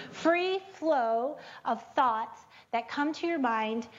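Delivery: free flow said slowly, every sound clear; slow and careful. Shown as a demonstration. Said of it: "don't say that too fast".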